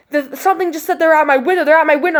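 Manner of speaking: high, rushed, shaken report; retold panic. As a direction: blurt it out in imitated panic. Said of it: "I'm, like, shaking now".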